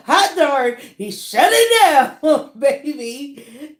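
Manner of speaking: loud, high whoop of praise; drawn-out, laughing celebration; hyped exclamation. Real, not acted. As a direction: holler it with a laugh. Laughing, hyped praise of the ranked person.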